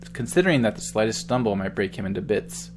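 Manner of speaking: ordinary talk, nothing marked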